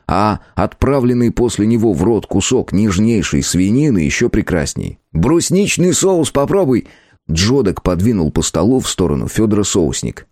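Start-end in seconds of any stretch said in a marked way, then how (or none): 5.1-6.9 lightly raised, cheeky elf voice; christmas elf. A narrator's reading. Elf character voice.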